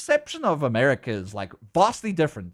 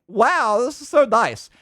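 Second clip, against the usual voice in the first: higher, louder wowed reaction; retold burst of happy amazement; pleasantly amazed; 8 semitones higher, 5 dB louder, slower than usual. Retells own amazed reaction.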